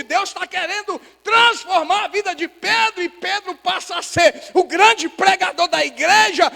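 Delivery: high preacher's voice building to a shout; impassioned preaching. Real, not acted. Pastor tells how God transforms Peter, impassioned preaching. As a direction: build phrase by phrase to full force.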